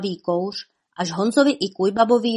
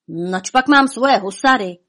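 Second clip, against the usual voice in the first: ordinary delivery, nothing marked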